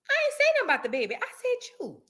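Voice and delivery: ordinary talk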